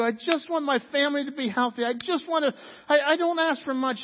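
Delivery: high, loud desperate plea; pleading cry to God. Retold or imitated. Believers crying out to God over loss, desperate. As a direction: beg God for answers. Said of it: "we look at somebody and we say".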